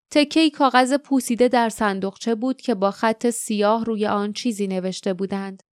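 usual speaking voice

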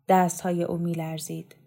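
ordinary delivery

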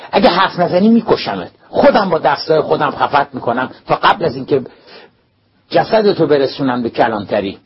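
loud, high, menacing shout; furious threat. A narrator's reading. Principal gripping the boy's collar, threatening him, furious. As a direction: threaten him with full fury.

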